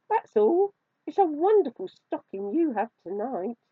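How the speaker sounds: high, loud squeaky mouse; high-pitched storybook mouse; little mouse voice. A narrator's reading. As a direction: pipe up high as a caught mouse. Narrator voices the caught mouse.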